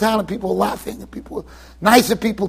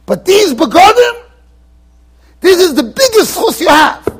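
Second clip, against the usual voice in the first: emphatic proclamation; 8 semitones higher, 6 dB louder than usual. Retold or imitated. Divrei Chaim tells Rav Pesach, loud emphatic proclamation. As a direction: proclaim it with full conviction.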